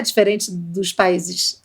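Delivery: usual speaking voice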